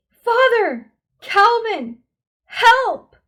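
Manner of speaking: very loud high panicked scream; screaming for help. A narrator's reading. Meg screams to father and Calvin for help, panicked. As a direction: shriek each name for rescue.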